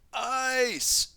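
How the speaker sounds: high, loud element cry. Acted. Zane calling his element for the tornado, high loud cry. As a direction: shout out the element. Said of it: "Zane cried".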